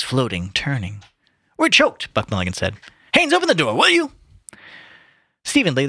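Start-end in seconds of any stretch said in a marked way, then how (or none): 1.6-2.1 high, loud outcry; exclaimed complaint. A narrator's reading. Mulligan in the smoke-filled room, high exclamation. 3.1-4.1 high, loud, urgent call. A narrator's reading. Mulligan calling Haynes to open the door, calling out. Calling across the smoky room.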